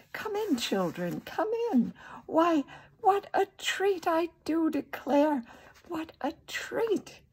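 put-on high-pitched, pleased old lady; delighted welcome. A narrator's reading. Old lady opens the door to children, delighted. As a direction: usher them in with lilting delight.

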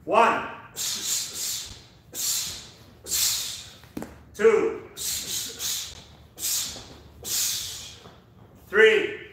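loud, high rep counting; shouted count. Real, not acted. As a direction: bark the counts over the music. Coach shouting reps in class.

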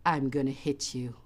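low, soft menace; hushed, low-pitched warning from an angry sister; very quiet threat. Retold or imitated. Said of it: "very quietly, she said".

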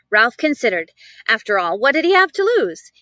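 ordinary delivery, nothing marked

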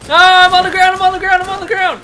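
high, loud panicked cry; panicking gamer. Real, not acted. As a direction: blurt it out fast in a panic. Downed in game.

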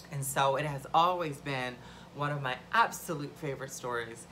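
normal talking voice

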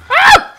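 sudden shrill scream of fright; startled scream. Real, not acted. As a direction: scream at the jump scare. Jump scare by monster.

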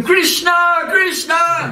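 high-pitched calling out of a name; storyteller's mock roar, pitched well up; retold roar. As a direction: cry it out high, imitating a devotee's roar. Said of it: "he began roaring".

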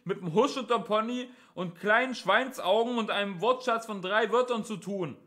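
high, loud policeman's holler; shouted, condescending warning; megaphone voice. A narrator's reading. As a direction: blare it out as if through a megaphone. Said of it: "sagte die Megafonstimme".

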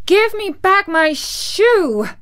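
high, loud, drawn-out demand; indignant child's outburst; angry little girl. A narrator's reading. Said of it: "grew angry and said to the witch".

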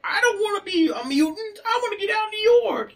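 very high cartoon complaint; high-pitched whine. Podcaster playing unwilling mutants, high-pitched whine. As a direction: squeal it as a whiny cartoon henchman. Acted complaint of human-form characters.

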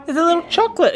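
high excited squeal. Real, not acted. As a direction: squeal with delight at treats. Finding treats everywhere.

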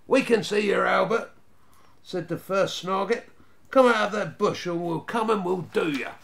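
put-on boy shouting threats at a bush; threatening shout. A narrator's reading. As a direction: yell at the bush to scare someone out.

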